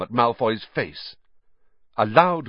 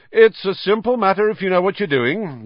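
higher, louder showman's voice; louder, higher pompous voice; loudly; 10 semitones higher, 5 dB louder than usual. Acted. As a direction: lecture with big self-assurance. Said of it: "Lockhart was saying loudly".

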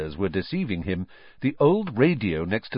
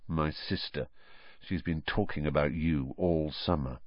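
low, soft confidential aside; undertone; 6 semitones lower, 8 dB quieter than usual. A narrator's reading. Brother explaining to his friend at the table, in an undertone. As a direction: tell it discreetly under your breath. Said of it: "in an undertone to Harry".

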